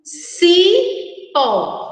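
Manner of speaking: "cipó" as a high, long sii, then pó; sounding out. Shown as a demonstration. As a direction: split "cipó" after CI. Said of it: "vamos falar novamente as duas palavras".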